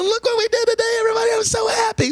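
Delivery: very high-pitched gushing imitation; giddy wife. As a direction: squeal it as if bursting with giddy delight. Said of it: "Steph's all giddy".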